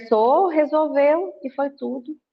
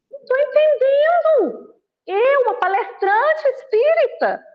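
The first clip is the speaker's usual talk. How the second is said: high, loud outraged disbelief; the shocked 'good' woman protesting her fate; mock indignant spirit; 11 semitones higher, 3 dB louder than usual. Lecturer playing herself arriving in the umbral, high and loud outrage. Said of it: "vou fazer".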